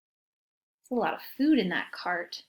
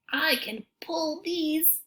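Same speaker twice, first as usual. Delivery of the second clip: dog voice; 5 semitones higher, slower than usual. Acted. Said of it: "The dog says".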